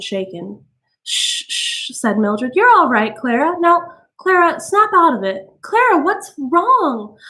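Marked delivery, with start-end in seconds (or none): 1.0-1.9 whispered shh; soft whispered shushing; hushing. A narrator's reading. Mildred hushing crying friend. 2.5-7.2 high, anxious soothing; high worried friend; flustered comforting. A narrator's reading. Mildred comforts Clara.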